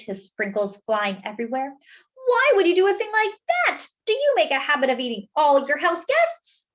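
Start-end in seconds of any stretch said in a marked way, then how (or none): 2.1-6.7 high, loud indignant demand; outraged. Acted. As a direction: scold him in disbelief. Arnie outraged at Mister Bing.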